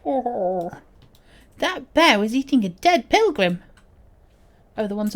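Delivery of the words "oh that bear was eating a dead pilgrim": put-on higher, louder voice of a young companion; high, loud childlike exclamation; startled companion voice. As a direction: exclaim it in a lively character voice.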